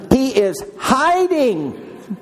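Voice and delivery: drawn-out emphasis. Real, not acted. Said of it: "repeats for emphasis".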